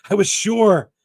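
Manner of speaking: high, loud cry of triumph and pain; exulting cry. A narrator's reading. The Intended crying out on hearing her name, exulting. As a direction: burst out, sure at last. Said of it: "an exulting and terrible cry".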